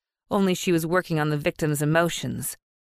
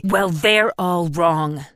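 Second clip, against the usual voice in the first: slow, hard and louder, cutting the dads off; sharp snap; 5 dB louder, much slower than usual. Acted. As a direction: slap them down with each word. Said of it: "She'd snapped so hard".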